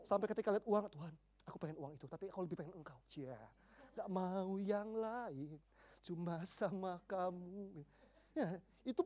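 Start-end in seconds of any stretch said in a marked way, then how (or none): 0.9-3.0 quick, much softer murmured prayer; hushed, earnest confession. Acted. Quoted prayer resisting money. 3.1-7.8 very slow, soft sweet-talk; syrupy, drawn-out tenderness; mock lovey-dovey. Lover-like devotion to God.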